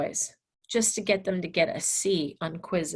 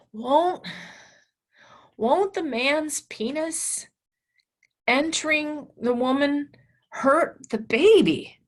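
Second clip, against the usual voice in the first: put-on worried boy; 6 semitones higher, 4 dB louder, slower than usual. Voicing worried student.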